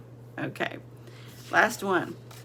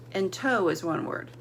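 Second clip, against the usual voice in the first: normal talking voice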